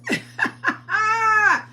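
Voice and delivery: 高く響く笑い声; loud high laugh. Real, not acted. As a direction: let out a loud high cackle. Laughing at viewer.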